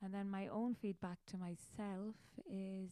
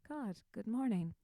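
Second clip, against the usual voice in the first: cheery hosting greeting; 3 semitones higher, 9 dB louder than usual. Acted. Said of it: "and interview God".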